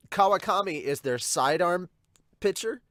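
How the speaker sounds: ordinary talk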